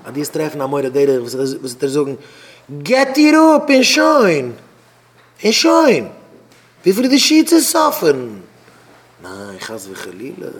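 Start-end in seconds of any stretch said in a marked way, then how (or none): 2.8-8.4 high, very loud acted outburst; urgent shouted advice. Adviser urging someone to split up at once, urgent shouting. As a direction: shout it as an insistent order.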